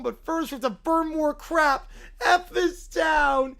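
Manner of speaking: put-on whiny angry little boy, shouting in bursts; furious child voice yelling at the town; Caillou tantrum. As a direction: throw a bratty tantrum as a spoiled kid. Caillou voice continues.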